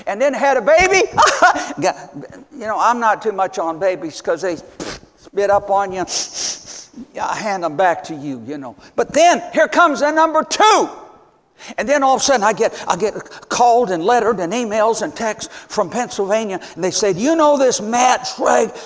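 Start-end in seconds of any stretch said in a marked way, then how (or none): 1.1-1.7 a quick high burst of laughter; amused laugh. Real, not acted. Preacher laughing to the church about the baby. 17.1-18.7 eager question. Retold or imitated. Callers from Pennsylvania asking.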